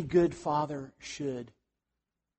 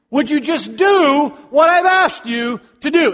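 booming, exasperated God, much louder and higher; preacher voicing God's rising exasperation; agitated God; 7 semitones higher, 7 dB louder than usual. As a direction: thunder at a stubborn servant. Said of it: "a little agitated with Moses".

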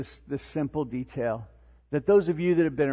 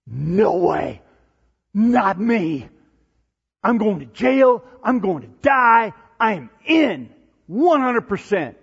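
loud, higher-pitched insistence as Peter; emphatic vow; 5 semitones higher, 8 dB louder, slower than usual. Acted. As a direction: protest with total, punchy conviction. Acting Peter's bold, loyal protest.

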